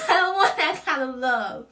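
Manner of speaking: high, sassy "nah" to a bad lover; 1 dismissive brush-off. Telling off someone who loves you wrong, sassy dismissal. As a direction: wave them off with attitude. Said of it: "be like".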